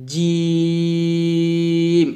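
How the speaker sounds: জিম with a firm j and very long level ee; correct firm JIM. Shown as a demonstration. Teacher shows how jim should sound, firm drawn জিম. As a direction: press জিম hard from mid-tongue, hold it. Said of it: "একটু শক্ত করে উচ্চারণ করবেন".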